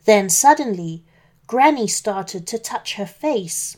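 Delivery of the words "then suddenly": plain reading voice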